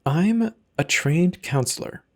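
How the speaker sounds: ordinary delivery, nothing marked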